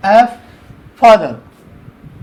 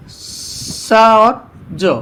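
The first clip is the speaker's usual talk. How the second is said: slow sounding out; much slower than usual. Shown as a demonstration. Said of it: "teacher demonstrates letter pair".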